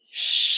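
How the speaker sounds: long loud shh; shushing sound. Shown as a demonstration. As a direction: shush like at a library. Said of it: "like you're shushing somebody".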